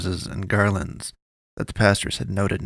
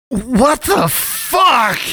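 drawn-out, much higher terrified yell; horrified yell; 6 dB louder, much slower than usual. A narrator's reading. Man sees her head fall off, terrified slow shriek. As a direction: shriek it in sheer horror. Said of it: "the man yelled".

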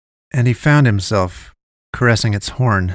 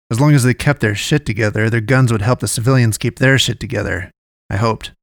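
ordinary delivery, nothing marked

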